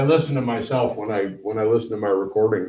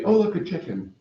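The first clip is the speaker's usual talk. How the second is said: higher, mock startled by something shiny; sudden distraction; 9 semitones higher than usual. ADHD T-shirt joke, higher mock sudden distraction. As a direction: snap off mid-sentence to the chicken. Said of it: "Ever seen the T-shirt that says".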